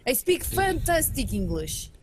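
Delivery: broken English in a put-on foreign accent; proud learner with a mock foreign accent. Trading put-on accent bits.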